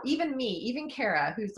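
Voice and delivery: ordinary talk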